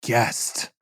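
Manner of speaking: unvoiced, teasing big reveal; dramatic reveal. Shown as a demonstration. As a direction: hold the pause, then drop to a breathy hush on guest. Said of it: "wait for it".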